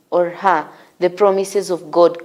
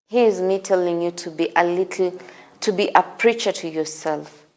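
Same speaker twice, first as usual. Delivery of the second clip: ordinary talk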